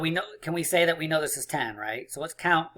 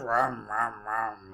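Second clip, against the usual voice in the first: low rumbling engine imitation; revving engine noise; 4 semitones lower, much slower than usual. Teacher before the next peek, low playful engine revs. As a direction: rev it like a car, low. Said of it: "Revving those engines".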